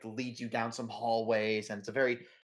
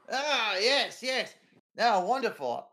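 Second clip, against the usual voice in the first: put-on higher, cheery old-chap voice; jovial English editor; 7 semitones higher than usual. Editor asking visitors about Bennett, cheery voice. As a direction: ask after an old friend heartily.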